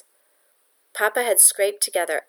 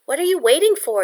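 higher and louder, needling her sister; challenging question; 9 semitones higher, 6 dB louder than usual. A narrator's reading. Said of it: "Yetta asked".

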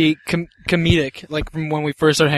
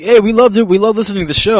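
imitating excited friends; 6 semitones higher, 5 dB louder, faster than usual.

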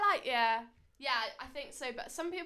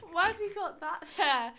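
laughing; 5 semitones higher than usual. Real, not acted. Laughing at friend.

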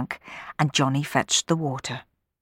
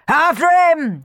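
high, loud chase call; shouting; 12 semitones higher, 7 dB louder, slower than usual. Acted. Sweeps chasing Sammy, shouting loudly. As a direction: yell it as you dash off. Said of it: "shouted Bob and Clem".

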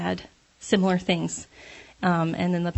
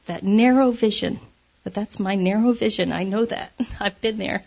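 ordinary talk

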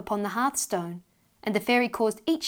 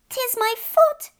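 very high, louder tiny wailing voice; a small fairy child crying for mother; sobbing fairy child; 6 dB louder, much slower than usual. Acted. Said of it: "sobbed the little fairy child".

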